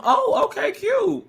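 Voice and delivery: high, sing-song mock of a bubbly guest. Mocking the guest's sugary reply, high and chirpy. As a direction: chirp it like a fake-sweet guest.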